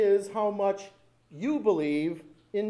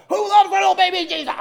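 all-out high scream of a demand; fast, full-throated yell imitating frenzied kids; intense final shout; 15 dB louder, faster than usual. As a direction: scream it at the top of your lungs. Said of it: "By the time they got the last time".

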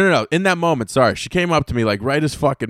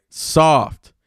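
normal talking voice

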